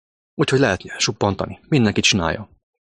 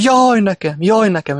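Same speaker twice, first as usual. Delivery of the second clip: high, loud whining wail of self-pity; mock pig squeal; 11 semitones higher, 5 dB louder, slower than usual. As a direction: squeal it like a pig in the barn, mocking. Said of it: "akkor visítunk, mint a disznók". (gúnyos, magas, hangos visítás)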